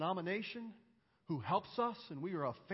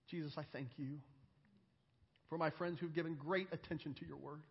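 closing prayer; 2 semitones lower, 5 dB quieter than usual. Real, not acted. Preacher thanking Jesus for his friends' attention, prayerfully. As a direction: thank Jesus quietly and reverently.

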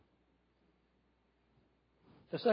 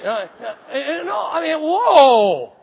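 flustered exclaim; 12 semitones higher, 11 dB louder, much slower than usual. Real, not acted. Realizes faux pas.

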